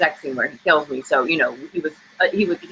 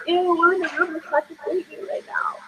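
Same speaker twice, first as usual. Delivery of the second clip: much higher, squeamish mock disgust; grossed-out disbelief; 13 semitones higher, 4 dB quieter than usual. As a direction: recoil as if the room just got creepy. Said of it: "we're like".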